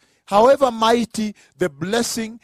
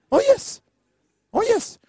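much higher-pitched interjection; insistent affirmation; 13 semitones higher than usual. Real, not acted. Preacher to congregation on what Abraham could say, high-pitched insistence.

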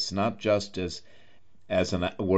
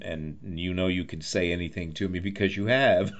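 normal talking voice

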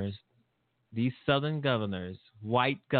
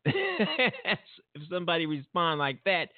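ordinary talk

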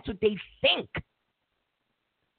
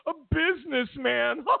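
high sneering laugh around the words; condescending anchor laughing at voters; mocking laugh; 7 semitones higher than usual. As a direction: scoff like a smug anchor. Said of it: "they'd be like".

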